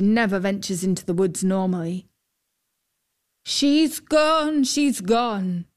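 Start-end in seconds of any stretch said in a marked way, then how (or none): 3.5-5.6 higher, distressed cry, falling away; understated narrated anguish. A narrator's reading. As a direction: cry out the loss of a child. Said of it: "she was crying".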